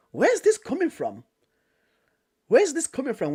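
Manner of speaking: high, puzzled asking around; mock baffled looking around; retold confusion.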